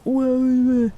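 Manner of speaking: loud, high, rowdy lad's whoa; football hooligan. Retold or imitated. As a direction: bellow it like a dim football lout. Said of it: "a football hooligan ... being like".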